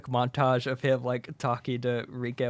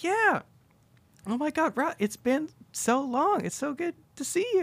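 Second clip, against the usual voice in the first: put-on much higher, sugary girlish voice; gushing Denise. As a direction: gush over an old flame. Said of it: "It's like".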